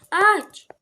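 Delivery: sharp high shouted command; shouting. Real, not acted. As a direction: yell the order. Shouted command to open door.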